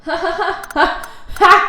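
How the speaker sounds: rire de folle aigu et exagéré; overacted anime dub. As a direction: ricanement de yandere, très aigu. Héroïne yandere qui rit. (rire hystérique surjoué)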